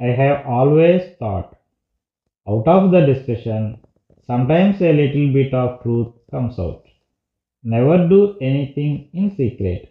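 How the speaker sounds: very slow, word-by-word dictation; slow reading aloud. Shown as a demonstration. Teacher reading example sentences to students, slow and deliberate. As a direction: read it out slowly for the class. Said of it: "reads example sentences slowly".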